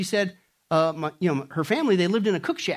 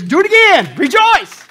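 high ringing shout of Rejoice; Paul's exhortation; 14 semitones higher, 14 dB louder, slower than usual. Acted. Preacher voicing Paul to the church, exultant shout. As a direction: cry it out as the apostle would. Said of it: "That's why Paul says".

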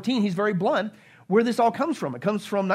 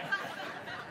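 breathy laugh; 13 semitones higher, 14 dB quieter than usual. Real, not acted. Laughs at congregation.

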